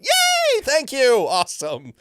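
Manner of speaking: very high, loud squeal of joy; hammed-up delighted yay; overjoyed cheer. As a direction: shriek thanks like you won. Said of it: "And I went".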